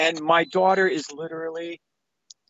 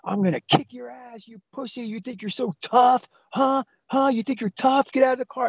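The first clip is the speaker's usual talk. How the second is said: higher-pitched imitation of an angry man; retold yell without full volume; mock yelling; 7 semitones higher than usual. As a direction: taunt and threaten as the raging husband. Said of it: "he's yelling at me".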